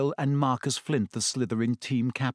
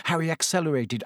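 ordinary delivery, nothing marked

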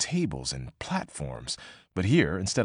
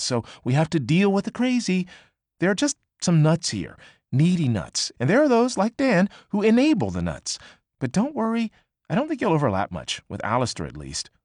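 higher, emphatic gossip; venting Annie; 7 semitones higher, 3 dB louder than usual. Acted. Annie dishing to May about Dan and Alistair, higher and emphatic. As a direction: vent about the nuts with relish.

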